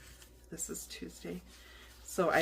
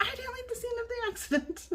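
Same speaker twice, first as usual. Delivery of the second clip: high-pitched, indignant protest; indignant retort. Retold or imitated. Retelling her reply to the officer, a high indignant protest. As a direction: squeak it out in disbelief.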